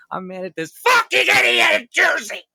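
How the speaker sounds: redirect the rage with a yell; angry shout. Acted. Boss shouting about someone in Jersey.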